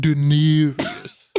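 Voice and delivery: very slow, stretched dramatic "Daenerys"; drawn-out name. Shown as a demonstration. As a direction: draw "Daenerys" out slowly like a grand title. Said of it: "That's why it was funny".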